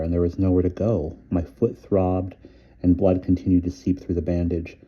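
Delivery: ordinary delivery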